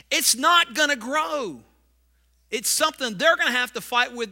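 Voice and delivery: preaching fervour. Acted. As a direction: drive each point home from the pulpit. Preaching the fourth principle.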